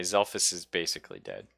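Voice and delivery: ordinary delivery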